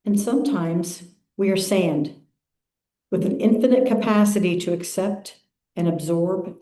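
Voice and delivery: unhurried, measured phrases with long pauses; calm, spacious meditation reading; guided meditation. Acted. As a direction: lead the congregation into stillness, phrase by phrase.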